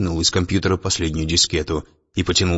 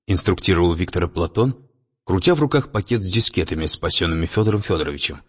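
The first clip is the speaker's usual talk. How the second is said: plain reading voice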